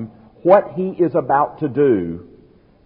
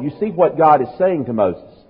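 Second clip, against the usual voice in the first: usual speaking voice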